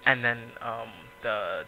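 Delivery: ordinary talk, nothing marked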